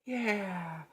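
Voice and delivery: high, sarcastic little cheer; mock cheer. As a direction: fake a thrilled fan, deadpan. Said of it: "No one's like".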